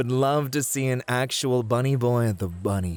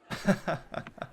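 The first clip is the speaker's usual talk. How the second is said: breathy little laugh; 7 semitones higher, 6 dB quieter than usual. Acted. Host laughs leaving for drinks.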